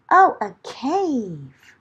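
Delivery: usual speaking voice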